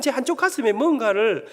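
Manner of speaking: normal talking voice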